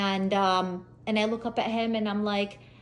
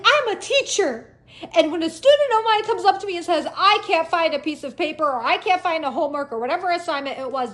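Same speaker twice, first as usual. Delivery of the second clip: high, loud indignant lecture; yelling; 7 semitones higher, 5 dB louder than usual. Retold or imitated. Teacher lecturing officer about her students, yelling. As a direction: shout it like a fed-up teacher. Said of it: "And I was yelling too".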